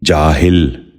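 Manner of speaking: جاہل said "jaa-hil", with i after the h; short i in the last syllable of جاہل; correct pronunciation. Shown as a demonstration. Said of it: "دوبارہ سنیے".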